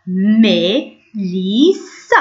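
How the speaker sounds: ميليسا very slowly, in three separated syllables. Shown as a demonstration. Teacher showing pupils how to pronounce a name, syllable by syllable. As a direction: split ميليسا into long, careful pieces. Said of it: "إذا ننطقوه ميليسا".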